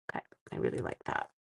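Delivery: breathy, hushed okay; whispered aside. Real, not acted. Card maker whispering her approval to herself. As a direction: whisper it to yourself.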